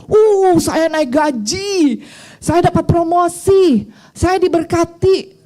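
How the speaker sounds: drawn-out, high, exaggerated cheering; mock elation. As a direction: stretch it out in hammed-up glee. Mimics elation over material blessings. (girang dibuat-buat)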